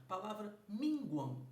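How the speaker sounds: slow down on minguam so the students hear it; careful minguam. Shown as a demonstration. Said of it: "Olha pra segunda sílaba".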